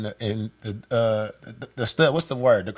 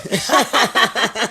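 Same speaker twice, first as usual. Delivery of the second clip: laugh; 6 semitones higher, 4 dB louder than usual. Real, not acted.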